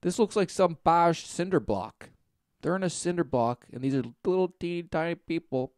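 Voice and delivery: usual speaking voice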